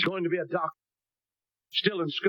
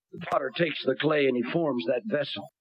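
usual speaking voice

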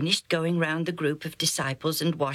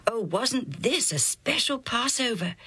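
higher, marvelling lift on "this"; amazed wonder; 6 semitones higher than usual. A narrator's reading. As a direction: wonder aloud at how special it was. Narrator marvels at special Passover.

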